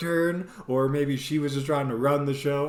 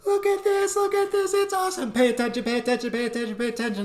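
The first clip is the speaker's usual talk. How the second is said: excited wife voice; 10 semitones higher, faster than usual. Retold or imitated. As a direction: squeal it like a thrilled shopper. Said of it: "goes full one eighty and goes".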